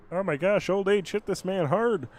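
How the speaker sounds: mock the old man's bad aim in a high voice, slowing down at the end; high teasing imitation of a friend. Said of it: "and then he went".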